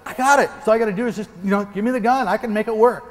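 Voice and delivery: lightly hammed-up overconfident bragging; mock cool guy. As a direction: play the guy who's got it all together. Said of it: "trying to be really cool".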